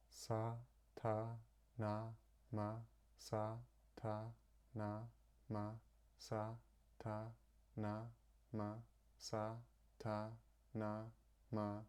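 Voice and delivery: soft guided mantra counting. Shown as a demonstration. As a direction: count the syllables softly under the breath. Softly counting held breath.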